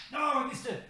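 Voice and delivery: very high, soft cry away from the mic; small high-pitched yelp of real frustration; dismayed cry. Real, not acted. Host swatting at a mosquito mid-show, dismayed cry. Misses a mosquito while hunting it.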